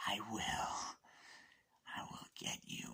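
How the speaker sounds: quiet menacing whisper; threatening whisper. Retold or imitated. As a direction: breathe the threat.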